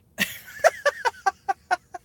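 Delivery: embarrassed crying laugh; teary high laugh through crying. Real, not acted. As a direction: laugh breaking through the tears. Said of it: "now you got to see me cry".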